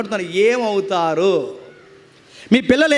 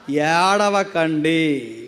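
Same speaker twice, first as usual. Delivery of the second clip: low, very slow, stretched word; drawn-out plea; 7 semitones lower, much slower than usual. Retold or imitated. Preacher quoting Jesus to the weepers, drawn-out and low. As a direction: draw it out low and slowly.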